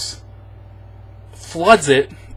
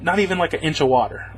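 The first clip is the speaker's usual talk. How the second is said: ordinary talk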